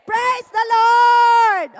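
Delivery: loud, drawn-out praise call; loud slow praise. Shown as a demonstration. Preacher leading the congregation in a loud praise. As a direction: lead the crowd in it. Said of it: "say it with me".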